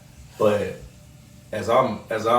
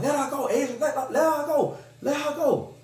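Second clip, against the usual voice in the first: much higher, pleading mother voice; his mom pleading for him to release her; imitating his mama; 14 semitones higher than usual. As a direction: beg him to let go, hammed up.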